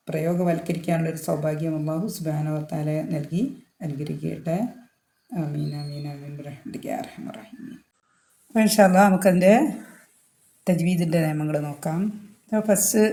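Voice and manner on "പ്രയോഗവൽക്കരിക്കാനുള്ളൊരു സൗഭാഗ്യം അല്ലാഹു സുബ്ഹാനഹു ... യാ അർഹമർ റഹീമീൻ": soft supplication fading to very quiet; murmured prayer. Real, not acted. Teacher praying to put the lesson into practice, fading murmured prayer. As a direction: pray softly, trailing almost to nothing.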